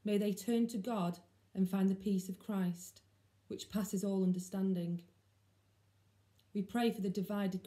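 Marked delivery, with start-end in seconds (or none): none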